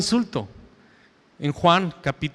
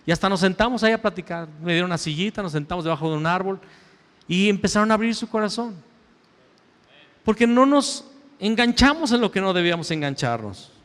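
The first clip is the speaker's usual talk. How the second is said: usual speaking voice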